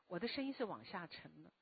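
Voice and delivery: ordinary talk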